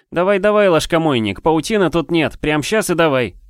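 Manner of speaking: high, loud goading jeer; angry taunt. Acted. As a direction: needle him hard, mocking. Ri angrily goading Ladyen.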